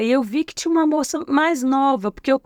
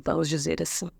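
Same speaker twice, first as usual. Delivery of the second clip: ordinary talk, nothing marked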